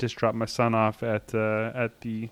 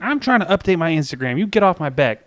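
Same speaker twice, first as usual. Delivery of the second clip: put-on bratty teenager; 5 semitones higher, 4 dB louder than usual. Dad mocks teen kids.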